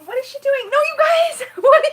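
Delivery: loud, high laughing protest; amused outcry. Real, not acted. Telling viewers about her partner's antics, loud high squeal. Partner throwing more tissues.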